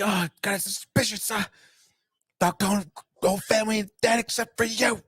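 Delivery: high, raspy suspicious-cop impression; raspy police chief. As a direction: mimic a barely intelligible raspy chief. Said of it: "that's what this guy sounds like in the scene".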